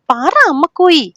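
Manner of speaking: high, loud, drawn-out demand; child out of patience, stretching the words; impatient child. Acted. Little girl urges her mother to speak, impatient. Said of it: "ക്ഷമ നശിച്ച് ഈ തവണ കുഞ്ഞി പറഞ്ഞുപോയി".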